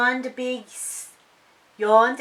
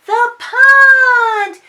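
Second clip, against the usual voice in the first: excited reveal; 14 dB louder than usual. A narrator's reading. Big reveal of the pond.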